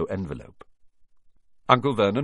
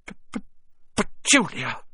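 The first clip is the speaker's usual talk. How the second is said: gasping; 8 semitones higher than usual. A narrator's reading. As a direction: choke out her name in shock. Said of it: "he gasped".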